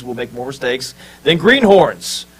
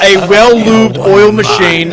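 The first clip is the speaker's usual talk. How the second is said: loud, higher, slow and drawn out; emphatic punchline; 5 semitones higher, 7 dB louder, slower than usual. Real, not acted. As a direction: belt it out slowly for effect. Chanted group punchline, drawn out.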